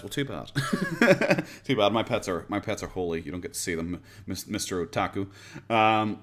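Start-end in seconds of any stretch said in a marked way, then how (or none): 0.6-1.6 high burst of laughter; teasing laugh. Real, not acted. Streamer refusing to show chat the pets, laughing. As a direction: laugh after teasing someone.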